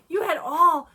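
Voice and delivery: loud, high imitation of awed kids; mock-awed kids exclaiming; impressed kids. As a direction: draw out ALL in loud amazement. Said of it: "They were so impressed".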